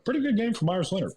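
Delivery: high, fast, self-mocking brag; mock boast. Player jokingly praising himself in third person, mock boast. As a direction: toot his own horn in jest.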